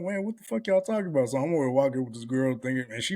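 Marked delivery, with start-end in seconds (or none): 0.0-1.3 higher-pitched indignant protest; mock indignant. Young man protesting to people around him, mock indignant. Retelling his baffled protest at onlookers.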